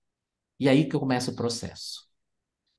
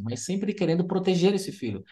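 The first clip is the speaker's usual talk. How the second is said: ordinary talk, nothing marked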